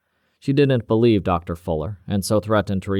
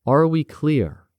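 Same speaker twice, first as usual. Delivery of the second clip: slow, higher, pointed challenge; slow pointed question; 7 semitones higher, 4 dB louder, slower than usual. Acted. Cavendish presses the silent doctor, slow and pointed. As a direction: let the question hang as a threat.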